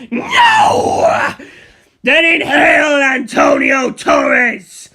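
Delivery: very high, loud yell, then a slow shouted command; battle shout. Acted. Fighter shouting orders at the giant zombie, full yell.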